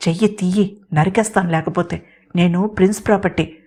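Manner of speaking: usual speaking voice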